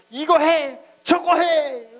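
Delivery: high, loud bossy commands; nagging parent. Retold or imitated. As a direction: mimic your own nagging at the kids. Mocking his own nagging voice. (높고 크게 잔소리하는 부모 흉내)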